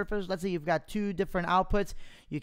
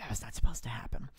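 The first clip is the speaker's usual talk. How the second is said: whispering; 4 semitones lower, 3 dB quieter, faster than usual. Real, not acted. Demo crashes, muttered aside.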